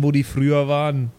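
normal talking voice

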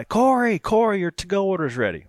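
high, loud call across the counter; calling out a name. Retold or imitated. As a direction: call the order out like a counter clerk. Staff calling out order name.

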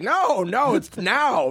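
high, put-on voice of an insistent guard; old security guard. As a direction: imitate a guard patiently correcting someone. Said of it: "This old security guard's like".

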